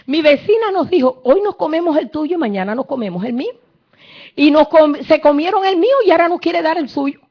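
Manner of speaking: a mother sobbing out her story; weeping woman. Acted. Preacher voicing the mother telling the king, high tearful plea. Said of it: "llorando al rey, diciendo".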